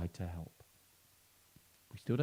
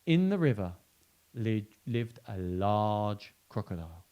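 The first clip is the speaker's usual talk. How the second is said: put-on lively read-aloud for children; animated picture-book reading; storybook narration; 4 dB louder than usual. A narrator's reading. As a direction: tell it like a bedtime story, with emphasis.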